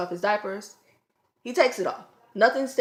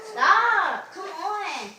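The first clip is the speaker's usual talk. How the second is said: exasperated scolding; 9 semitones higher, much slower than usual. Real, not acted. Scolding kids off camera.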